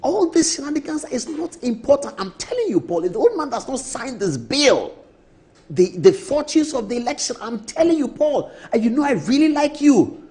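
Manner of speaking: hammed-up pushy colleague urging; high pitched mock nagging about the bill; imitating Brother Fo. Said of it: "I said".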